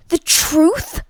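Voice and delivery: loud, high outraged roar. A narrator's reading. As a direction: roar back at the inspector. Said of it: "roared Lord Hastings".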